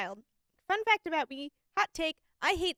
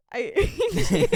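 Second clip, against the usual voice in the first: laughing hate; 6 dB louder, slower than usual. Retold or imitated.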